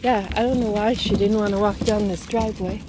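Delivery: ordinary talk, nothing marked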